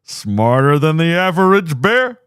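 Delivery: high, loud, slow cartoon bear voice; Yogi Bear. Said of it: "I sound more like Yogi".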